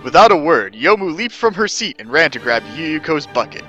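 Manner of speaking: ordinary delivery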